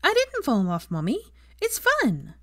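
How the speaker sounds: put-on high, bright little girl. A narrator's reading. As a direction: insist happily like a child. Child Rose voiced.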